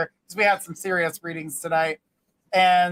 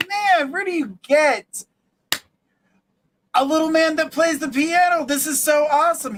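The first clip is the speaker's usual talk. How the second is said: amazed bartender; 7 semitones higher than usual. Acted. Bartender asking where he got the little man, thrilled.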